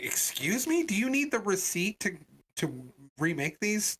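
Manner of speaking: high, affronted questioning; indignant. Retold or imitated. Customer's indignant retort, retold.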